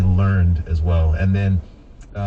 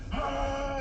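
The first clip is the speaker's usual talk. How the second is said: gym grunt shout; 9 dB quieter than usual. Retold or imitated. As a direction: blurt out a showy gym grunt. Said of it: "like, are just".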